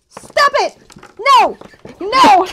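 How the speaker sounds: high, loud shouted protest; frantic protest. Real, not acted. Player fights off opponent in dice game, frantic protest. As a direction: shriek at your opponent to quit.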